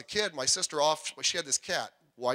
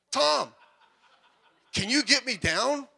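pleading call; 7 semitones higher, 5 dB louder, slower than usual. Retold or imitated. Hanging upside down, asking for help.

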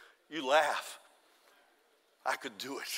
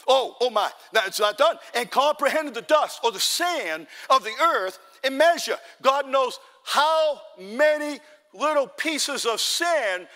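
raised pulpit delivery with stretched key words; forceful preaching; 6 semitones higher, 6 dB louder than usual. Real, not acted. Preacher marvels at God measuring the universe, emphatic raised preaching. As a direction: press the congregation for amens, punching key phrases.

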